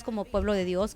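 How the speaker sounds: ordinary delivery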